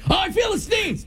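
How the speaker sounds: high shouted "Oh, I feel the sneeze"; loud cue shout. Shown as a demonstration. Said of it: "So when I say, "Feel the sneeze".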